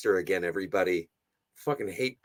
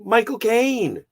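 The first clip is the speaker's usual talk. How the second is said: excited; 11 semitones higher, 7 dB louder, slower than usual. Real, not acted. Excited name call reading cast.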